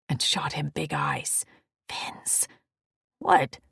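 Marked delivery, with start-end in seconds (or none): 1.9-2.5 urgent whispered warning; whispering. Acted. Warning him, shooting big eyes. 3.2-3.6 short loud snap; snapping. Acted. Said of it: "he snapped".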